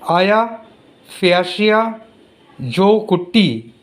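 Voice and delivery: "Aya, Fiasia, Jokutti", broken into separate parts; demonstrated pronunciation. Shown as a demonstration. Teacher showing children how the name is pronounced, careful pronunciation. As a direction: sound out "Aya Fiasia Jokutti" piece by piece, drawing out "Jokutti". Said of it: "pronounced as".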